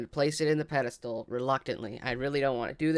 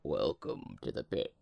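very deep, slow put-on greeting; [name]'s voice; 11 semitones lower, 4 dB quieter, slower than usual. Player retries the pit's greeting, very deep imitation voice. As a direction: drop right down and drag it out, imitating. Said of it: "I cannot recreate it".

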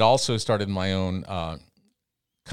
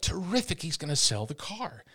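high, eager inner voice; thinking it with a jump of glee; mock excited thought; 8 semitones higher, 4 dB quieter than usual. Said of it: "And I thought".

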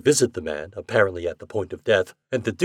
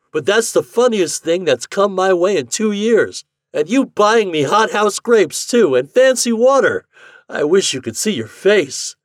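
higher, louder gleeful mockery; taunting; 10 semitones higher, 8 dB louder than usual. A narrator's reading. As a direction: rub his nose in it, laughing. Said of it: "he taunted".

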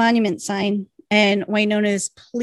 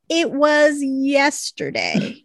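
drawn-out, emphatic mock retort; pointed slow retort; 4 semitones higher, much slower than usual. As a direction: stretch each word to make the point. Retold indignant retort to a plea.

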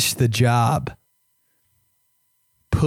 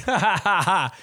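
1 forced laugh; 6 semitones higher than usual. Retold or imitated.

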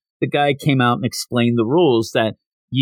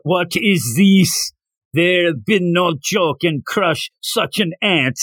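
put-on Russian strongman accent; 4 semitones higher, slower than usual. Circus strongman sizing up little Goku, slow Russian accent. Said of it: "complete with huge mustache and Russian accent".

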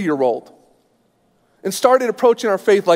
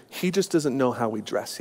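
overheard murmur; 6 semitones lower, 9 dB quieter than usual. Retold or imitated. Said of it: "I hear out of the corner of my ear".

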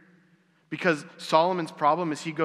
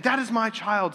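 lightly voiced woman, pitched up; claiming woman; 8 semitones higher than usual. Woman claiming the child before Solomon, pitched-up insistence. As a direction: insist on it, higher than your own voice. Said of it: "the woman who's saying".

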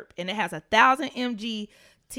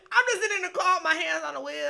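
ordinary talk, nothing marked

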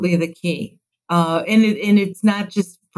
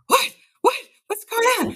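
alarmed mom. Retold or imitated. Mom woken by late call.

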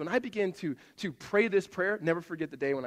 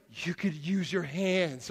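put-on kid's explanation; much slower than usual. Son voiced explaining.

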